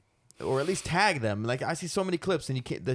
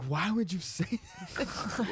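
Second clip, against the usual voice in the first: whiny complaint; 8 semitones higher, 3 dB quieter, much slower than usual. Retold or imitated. Retelling scolding partner afterwards.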